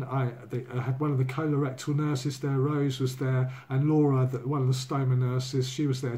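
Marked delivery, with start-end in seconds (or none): none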